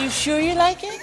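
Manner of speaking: soft, high-pitched impression. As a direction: lift it high and keep it gentle. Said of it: "He said".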